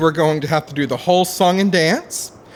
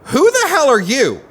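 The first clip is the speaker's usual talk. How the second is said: startled shout; 10 semitones higher, 3 dB louder than usual. Acted. Stranger surprises intruders.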